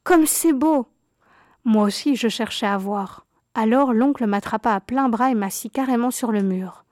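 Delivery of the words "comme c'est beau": high, loud, thrilled cry of wonder; delighted exclamation. A narrator's reading. Cousin peeking over the wall at the ball, crying out thrilled. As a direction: gasp it out in pure delight at the gowns. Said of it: "s'écria-t-elle".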